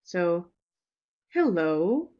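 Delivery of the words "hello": "Hello" with a small rise then fall. Shown as a demonstration. Teacher shows learners the rise-fall on a greeting. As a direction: lift "Hello" a little and let it fall. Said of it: "you can see the little rise fall there".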